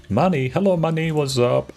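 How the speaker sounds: normal talking voice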